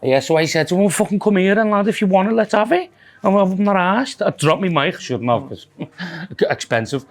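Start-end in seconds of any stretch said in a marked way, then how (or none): 0.8-2.9 mild mock challenge. Retold challenge to fight.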